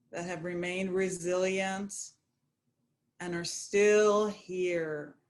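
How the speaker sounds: unhurried, even, calming guidance; guided meditation. Acted. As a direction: settle listeners gently, drawing out key words.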